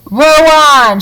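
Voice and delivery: high, loud cry to the rowers; rally the rowers with a shout; shouted order. A narrator's reading. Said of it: "shouted Mister Wonka".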